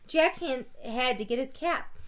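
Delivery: usual speaking voice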